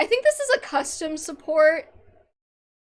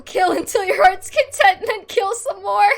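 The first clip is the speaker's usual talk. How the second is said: higher, much louder villainous urging; hammed-up bloodthirsty mother; 6 semitones higher, 11 dB louder than usual. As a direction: ham up the gleeful killer mom. Mother urging son to kill.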